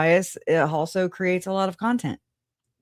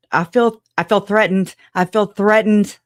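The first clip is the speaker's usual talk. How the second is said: mock threatened; 3 semitones higher, 7 dB louder than usual. Mock alarm at a viewer.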